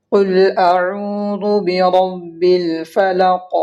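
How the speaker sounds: slowly recited "قل اعوذ برب الفلق" with a bouncing final qaf; "الفلق" stopped on a heavy, echoed qaf in slow recitation; qalqala on final qaf. Shown as a demonstration. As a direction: chant "قل اعوذ برب الفلق", echoing the last ق. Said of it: "ہم یہاں پہ قلقلہ کریں گے".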